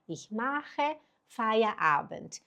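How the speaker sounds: ich mache Feierabend very slow and soft; slow model phrase. Shown as a demonstration. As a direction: say it slowly and gently for the student. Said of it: "A frase número um seria". (ich mache Feierabend bem devagar)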